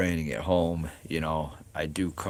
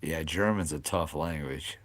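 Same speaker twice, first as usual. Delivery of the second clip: ordinary talk, nothing marked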